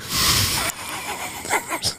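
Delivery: breathy wheezing laugh; silent laugh. Real, not acted. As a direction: wheeze it out quietly. Laughing at joke.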